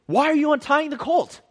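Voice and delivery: high, loud owner's protest; indignant challenge. Retold or imitated. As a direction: call it out like an owner catching thieves. Donkey owner challenging the disciples.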